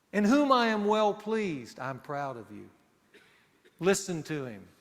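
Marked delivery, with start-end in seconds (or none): 0.1-1.8 emphatic proclamation. Retold or imitated. God's words, emphatic. 1.8-2.7 tender fatherly aside. Retold or imitated.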